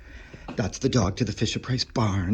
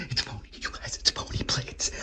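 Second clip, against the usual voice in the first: breathless whisper ending in a sharp gasp; thrilled whisper; 5 dB quieter than usual. Real, not acted. Collector whispering to viewers on finding pony plates, thrilled. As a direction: whisper it, then gasp in delight. Discovering a beloved childhood toy.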